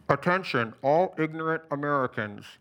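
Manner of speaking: megaphone voice; shouting into a bullhorn. A narrator's reading. Alex addresses the crowd through his bullhorn. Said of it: "Alex shouted into his bullhorn".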